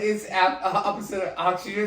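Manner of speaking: ordinary talk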